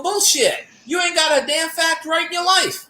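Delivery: retold angry retort. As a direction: yell it at the know-it-all YouTuber.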